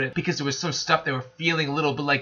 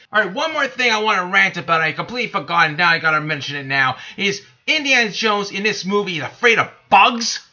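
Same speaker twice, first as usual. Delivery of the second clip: higher and louder, fired-up ranting; real exasperated rant, raised and loud; angry rant; 5 semitones higher, 5 dB louder than usual. Real, not acted. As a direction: vent your fury at the screenwriters. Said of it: "I am so pissed".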